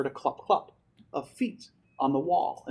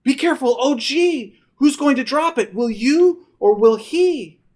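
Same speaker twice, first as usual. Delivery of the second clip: high, loud frantic calls; anxious shout; 11 semitones higher, 9 dB louder than usual. Acted. Boy shouts worried warnings to grandpa, high frantic yell. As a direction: shout up in alarm. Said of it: "I shouted".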